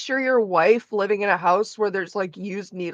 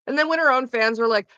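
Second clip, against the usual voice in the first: usual speaking voice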